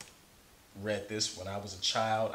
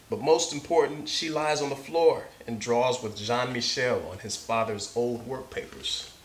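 story narration; 3 semitones higher, 7 dB louder than usual. Acted. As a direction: read it like to a class of kids. Reads picture book aloud.

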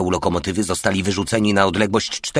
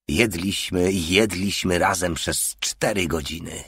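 usual speaking voice

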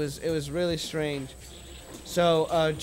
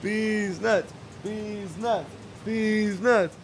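higher, sing-out punchline; crowing the punchline in a raised pitch; gleeful gloating repeat; 5 semitones higher, much slower than usual. Real, not acted. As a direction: repeat it with relish.

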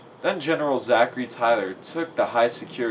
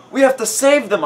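high, loud heroic shout; urgent storybook shout; 12 semitones higher, 5 dB louder than usual. A narrator's reading. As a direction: shout it out, pitched way up. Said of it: "I shouted".

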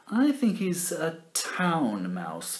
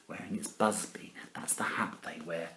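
whisper; 8 semitones lower, 11 dB quieter than usual. Acted. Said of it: "whispered aside".

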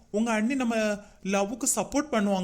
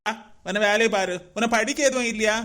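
ordinary talk, nothing marked

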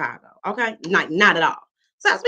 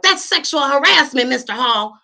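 raised, loud mock outrage; mock offended; 8 semitones higher, 8 dB louder than usual. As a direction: play the offended secretary, big. Sister acting offended at dad.

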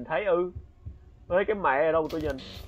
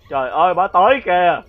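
scared shout; 5 semitones higher, 12 dB louder than usual. Real, not acted. The ghost grandmother approaches.

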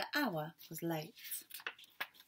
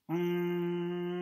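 louder humming mm; fridge hum; 6 dB louder than usual. A narrator's reading. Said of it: "went the refrigerator".

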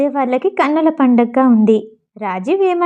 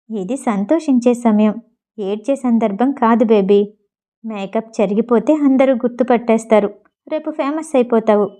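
plain reading voice

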